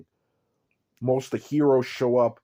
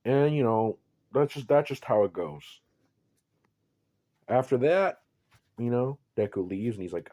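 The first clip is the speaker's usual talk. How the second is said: normal talking voice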